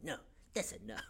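soft, deadpan mock refusal; understated kid putting foot down; child's refusal. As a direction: say it quietly with finality. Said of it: "and then being like".

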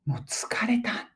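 weary, slow half-whisper; acted exhaustion. Shown as a demonstration. Teacher acting out the slang's meaning for viewers, exhausted. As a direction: sigh it out softly, as if worn out.

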